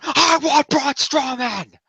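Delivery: high and very slow, each word spaced out; final demand. Retold or imitated. Sami's last answer to Foley, very slow and high. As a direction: lean into every word like a vow.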